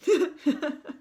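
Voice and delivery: high, loud giggling; kids' giggles. A narrator's reading. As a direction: giggle in short bursts like children. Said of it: "fits of giggles from the kids".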